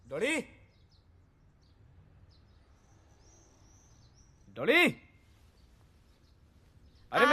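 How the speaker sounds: high, rising call to another room. Acted. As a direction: call out for someone, pitch climbing. Calling her from another room. (दूसरे कमरे से पुकारते हुए)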